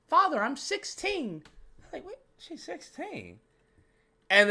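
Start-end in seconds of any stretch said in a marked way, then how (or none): 0.1-1.4 high-pitched mock teenage girl; put-on high girlish voice; teenage girl quote. Quoting the teenage film character. 2.0-3.4 high, hushed, drawn-out disbelief; tiny high incredulous voice; shocked disbelief. Retold or imitated. Retelling his shocked reaction.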